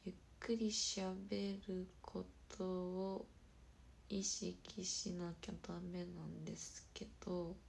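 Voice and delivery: very slow, drawn-out syllables; slow-motion speech, evenly paced; deliberately slow. Shown as a demonstration. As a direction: show careful slow talking, stretching each word.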